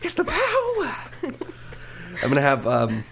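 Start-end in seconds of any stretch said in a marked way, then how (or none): 0.0-1.3 squeaky high mock-mystic voice; high silly voice. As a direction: go way up high, half laughing. Playful high channeling voice, laughing.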